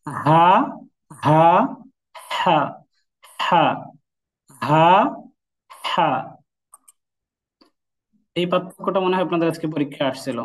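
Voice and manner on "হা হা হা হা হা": হা pushed out loud from the throat; strong loud breathy হা; emphatic Haa. Shown as a demonstration. Teacher drilling the throat letter loudly. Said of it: "এই হরফটা?".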